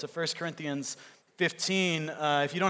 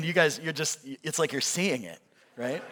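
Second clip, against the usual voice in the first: ordinary delivery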